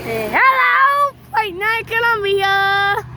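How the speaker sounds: high, slow, stretched greeting; calling out hello. Real, not acted. Kid greets a passing driver, high and drawn out. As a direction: wave down the driver.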